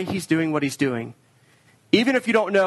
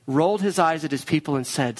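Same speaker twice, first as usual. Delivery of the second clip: usual speaking voice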